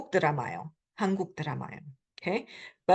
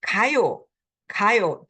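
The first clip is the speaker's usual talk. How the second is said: 가요 punched on 가, then falling; bossy 가요 with the weight on the first syllable; first-syllable stress; 4 dB louder than usual. Shown as a demonstration. Teacher shows the command form, 가요 stressed on 가. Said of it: "emphasis is usually in the, um, the very first syllable".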